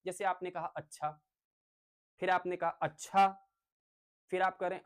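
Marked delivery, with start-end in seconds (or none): 0.7-1.1 "अच्छा" said evenly with a falling end; सहमति वाला अच्छा. Shown as a demonstration. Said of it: "अलग-अलग कहने के अंदाज से". 2.7-3.3 "अच्छा" with a rising, questioning end; अलग अंदाज का अच्छा. Shown as a demonstration. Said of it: "अलग-अलग कहने के अंदाज से".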